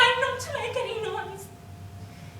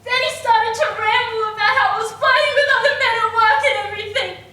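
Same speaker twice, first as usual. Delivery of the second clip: loud, higher, mounting outrage; agitated outburst; 5 semitones higher, 9 dB louder than usual. Acted. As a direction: get carried away reliving the tirade. He started to yell.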